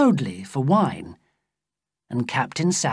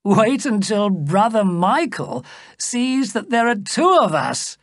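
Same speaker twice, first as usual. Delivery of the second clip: high, animated exclamation; gleeful cry; 6 semitones higher, 3 dB louder than usual. A narrator's reading. The king, laughing, pictures his brother's surprise, gleeful cry. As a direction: cry it out with amused relish. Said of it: "he cried".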